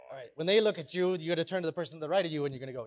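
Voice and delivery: ordinary talk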